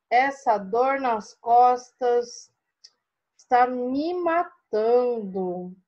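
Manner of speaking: very slow, pained drawn-out moan; aching complaint. Shown as a demonstration. Teacher voicing the spider with back pain, slow pained complaint. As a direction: stretch every word like your back is killing you. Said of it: "Tá vendo como mudou a nossa leitura?".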